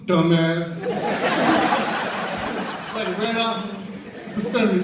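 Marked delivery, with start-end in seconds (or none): 0.0-0.7 very loud insult with laughter in it; blunt insult. Retold or imitated. Leo looking at his fallen crying son, blunt insult. 0.8-2.9 loud, long breathy burst of laughter; laughing. Real, not acted. Teller finishing the swing story, laughing.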